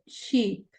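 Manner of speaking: sheep with a long stretched ee. Shown as a demonstration. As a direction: draw out the ee in sheep. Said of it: "por ejemplo".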